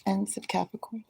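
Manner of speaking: soft whisper, almost to herself; hushed mention in passing; whispered aside. Real, not acted. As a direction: slip it in as a secret whisper. Repeats Capricorn aside in whisper.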